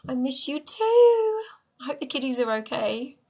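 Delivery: high, long-stretched sweet reply; sing-song fondness, drawn right out; affectionate. Real, not acted. Answering Alyssa that the missing is mutual, affectionately.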